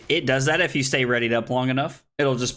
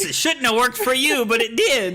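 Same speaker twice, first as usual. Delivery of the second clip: high, squeaky mock disbelief; incredulous; 12 semitones higher, 4 dB louder than usual. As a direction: pitch it way up in disbelief. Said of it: "I was like".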